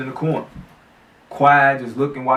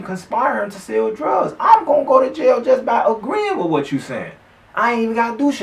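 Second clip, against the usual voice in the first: panicked inner voice; 8 semitones higher than usual. Retold or imitated. Retelling his own inner panic.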